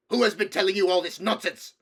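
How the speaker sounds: very loud, high-pitched furious roar; voice of thunder. A narrator's reading. King demanding who told his nephew, voice of thunder. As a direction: thunder at the child. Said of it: "in a voice of thunder".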